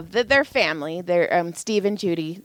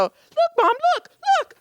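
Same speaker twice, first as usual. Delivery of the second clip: very high, exaggerated childish whine; mock him like a pestering toddler. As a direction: mom parodying son's whining. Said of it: "And I go".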